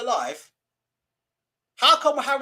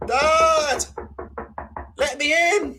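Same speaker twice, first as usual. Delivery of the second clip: high, louder begging son voice; pleading son; 8 semitones higher, 4 dB louder, much slower than usual. Retold or imitated. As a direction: whine to be let in like a son locked out. Son begging father at door.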